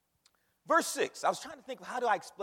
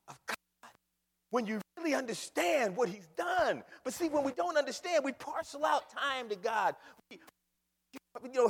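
ordinary talk, nothing marked

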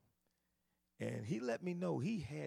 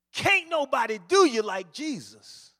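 Can't nobody do you like Jesus called out louder; a little bit louder; 8 semitones higher, 15 dB louder than usual. Retold or imitated. Street preacher gets louder at the staring crowd, louder call. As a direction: lift the volume on Jesus. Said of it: "he got a little bit louder".

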